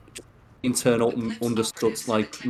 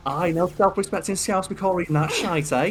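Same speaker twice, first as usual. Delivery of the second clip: higher-pitched amateur Scouse impression; bad Scouse accent; 7 semitones higher than usual. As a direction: imitate a Liverpudlian, badly. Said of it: "I'm not good at accent".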